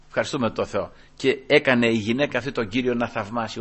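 ordinary delivery

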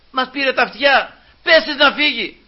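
high, loud exasperated outburst; acted fed-up complaint. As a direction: complain like someone sick of the noise. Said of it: "τη βαρεθήκανε".

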